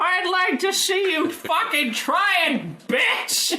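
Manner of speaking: put-on high taunting old man; old man boss. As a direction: sneer like a cranky old boss.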